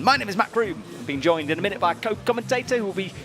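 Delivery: ordinary talk, nothing marked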